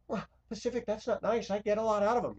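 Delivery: mocking a prim viewer's complaint; imagined viewer objecting. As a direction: mimic a disapproving churchgoer. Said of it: "Yeah, and I see the kind that you all are".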